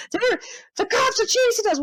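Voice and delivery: very high mock wail; panicked caller. High guy fearing the cops, mock panicked. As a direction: imitate a squeaky, frantic cry.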